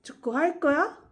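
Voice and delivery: 축구할 거야 as a question, pitch climbing at the end; question intonation. Shown as a demonstration. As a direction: lift the end of 축구할 거야 high to ask. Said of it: "I'll repeat the question form once again".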